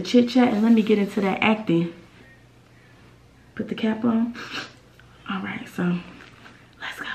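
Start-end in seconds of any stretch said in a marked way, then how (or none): 3.5-7.1 hushed whisper before starting. Real, not acted. Whispering to camera before the acting begins. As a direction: whisper it to get ready.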